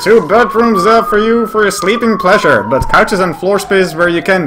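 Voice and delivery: announcer voice. Acted. Partner joins the ad read.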